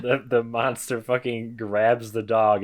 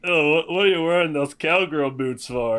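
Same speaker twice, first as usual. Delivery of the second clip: raised-pitch mock drunk heckling; comically drunk, belligerent; 6 semitones higher, 3 dB louder than usual. Drunk brother at the bar talking to a lady, mock belligerent. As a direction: play a belligerent drunk for laughs. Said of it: "really comically drunk and, like, belligerent".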